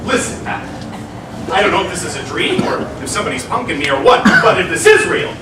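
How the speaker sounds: rapid, tumbling gush; giddy, fast starstruck babble; amazed excitement. Acted. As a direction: race breathlessly from thought to thought in amazement. Amazed young man meets the ghosts.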